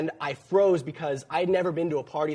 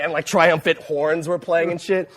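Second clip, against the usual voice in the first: usual speaking voice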